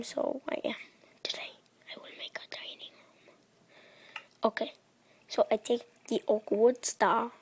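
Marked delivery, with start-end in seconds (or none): 1.2-3.4 fast hushed whisper. Real, not acted. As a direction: breathe the line out, no voice. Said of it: "I don't know why I'm speaking like this".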